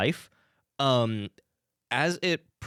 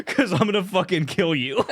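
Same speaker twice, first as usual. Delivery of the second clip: laughing through a mock threat; 9 semitones higher, 4 dB louder than usual.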